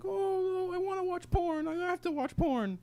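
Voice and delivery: mocking crying classmates in a high whine. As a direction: wail like a spoiled classmate. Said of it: "they were crying".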